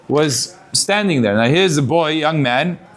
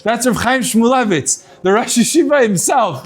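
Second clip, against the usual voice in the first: higher, startled correction; surprised reveal; 6 semitones higher than usual. Retold or imitated. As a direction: blurt out the shock of who he really is. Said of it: "he does a".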